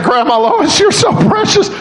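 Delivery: shouting with joy at a sweet grandma; excited shout. Retold or imitated. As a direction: gush over her at the top of your voice.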